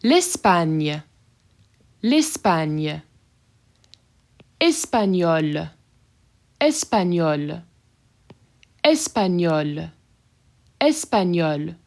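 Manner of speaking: slow French Espagnol with a clear final L; French pronunciation. Shown as a demonstration. Teacher showing no change in pronunciation, careful French. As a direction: say Espagnol slowly, same both ways. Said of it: "So here you have no difference here".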